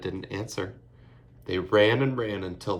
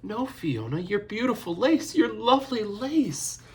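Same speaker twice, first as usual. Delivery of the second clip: rising higher and louder into a wail; hysterical scream; 13 semitones higher, 4 dB louder than usual. A narrator's reading. Sister wails as Fiona cuts her lace. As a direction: cry out in horror as the lace is cut. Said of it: "Eilish screamed as she became hysterical".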